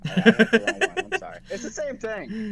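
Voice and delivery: high, laughing admission of a mistake; real laughing apology. Real, not acted. Player owning up to game master about wrong stat, laughing. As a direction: blurt it out through laughter.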